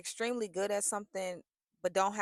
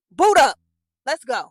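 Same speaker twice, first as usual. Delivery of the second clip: loud, high rallying order; loud command from God; 6 semitones higher, 15 dB louder than usual. Retold or imitated. God ordering her to work, loud and high. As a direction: bark it like a coach. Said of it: "He like".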